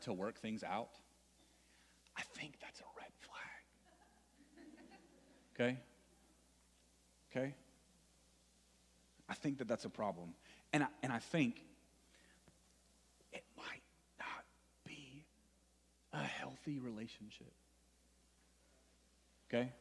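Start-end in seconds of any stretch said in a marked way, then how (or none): 2.2-3.4 whisper. Acted. Youth talk, stage whisper aside. 13.3-17.5 whisper. Acted. Hushed aside to students.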